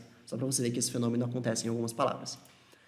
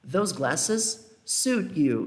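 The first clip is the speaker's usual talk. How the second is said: slow model sentence; much slower than usual. Shown as a demonstration. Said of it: "teacher repeats example slowly".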